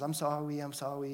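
soft, lightly imitated toddler mumbling sorry; toddler apology. Two-year-old apologizing to his brother, lightly imitated mumble. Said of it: "Jude goes and says".

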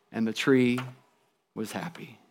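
low, very soft closing line with a pause; hushed story ending. A narrator's reading. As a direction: let the last words fade away tenderly. Poignant close of the story.

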